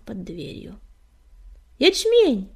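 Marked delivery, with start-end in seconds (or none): 1.8-2.5 high, loud, delighted call; happy shout of welcome; joyful greeting. A narrator's reading. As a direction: hail an old friend with delight. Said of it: "радостно поздоровался он".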